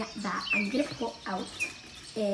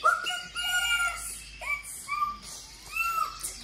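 excited squeal; 5 dB quieter, much slower than usual. Real, not acted. Excited over a toy puppet.

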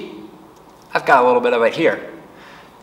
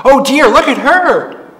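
put-on high, louder worried old woman; worried granny crying out, much higher; alarmed granny; 13 semitones higher, 6 dB louder than usual. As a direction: fret aloud in fear.

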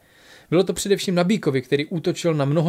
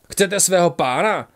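slow, firm, loud challenge in a changed voice; calling out firmly; 3 semitones higher, 4 dB louder, slower than usual. A narrator's reading. Tygr calls to the attackers holding their master, slow firm call. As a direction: lay down the terms, slow and steady. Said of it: "zavolal rozhodně".

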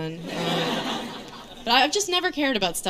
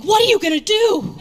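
high, loud acted alarm; mock panic; 10 semitones higher, 7 dB louder than usual. Comic to pregnant friend on the phone, mock panic. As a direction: blurt it out in hammed-up panic.

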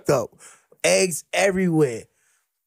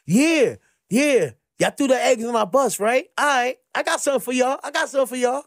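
street taunt; 7 semitones higher than usual. Retold or imitated. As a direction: taunt them like you've got a surprise. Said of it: "I came back with my street shit".

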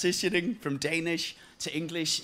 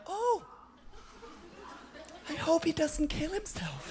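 mock pity; 10 semitones higher, 6 dB quieter than usual. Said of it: "you're like".

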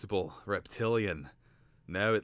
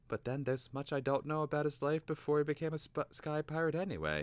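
put-on higher, musing boy voice; young boy; 9 semitones higher than usual. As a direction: wonder aloud, boyish. Twig voiced in story.

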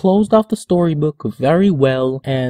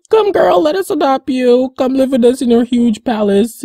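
creepy invitation; 8 semitones higher than usual. Retold or imitated. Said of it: "they came in all creepy".

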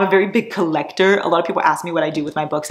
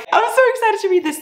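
much higher and fast, gushing; excited squeal; faster than usual. Real, not acted. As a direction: burst out with excitement. Excited reaction to mafia book.

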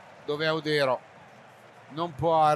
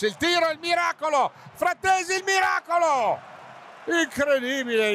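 high shouted disbelief at the save; breathless Italian goal commentary; 11 semitones higher, 4 dB louder than usual. Real, not acted. Keeper's miracle save, commentator shouts. (telecronaca concitata e urlata)

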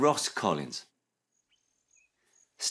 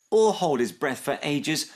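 higher, pressing repeat; crying out; 6 semitones higher, 3 dB louder than usual. A narrator's reading. Snip repeating Max's point to mum, crying out. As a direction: echo it insistently, more forceful. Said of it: "cried Snip".

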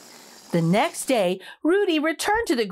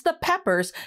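ordinary delivery

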